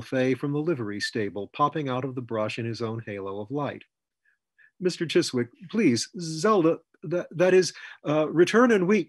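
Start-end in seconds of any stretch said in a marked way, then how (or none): 4.8-9.1 high, halting, flustered plea; flustered pleading. A narrator's reading. Rollo begs Chiswick about Zelda, flustered and stammering. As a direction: stammer it out urgently, pitched up and louder.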